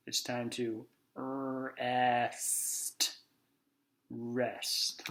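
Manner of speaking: very slow, drawn-out, drowsy; sleepy drawl. Acted. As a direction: stretch it out like falling asleep. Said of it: "Is it nap time?".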